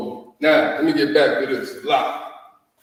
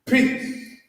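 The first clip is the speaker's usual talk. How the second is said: high breathy peace; 10 semitones higher than usual. Shown as a demonstration. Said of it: "Which basically means".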